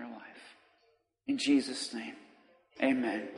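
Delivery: quiet, low, hushed prayer voice; gentle pastoral prayer; soft prayer. Acted. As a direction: pray softly over the church. Leads prayer.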